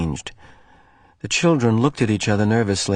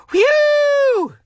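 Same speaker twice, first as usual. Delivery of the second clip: high mock gunfire whoosh; child's play-gun noise from a bush; whistling whoosh; 6 dB louder than usual. Child's voice making sound.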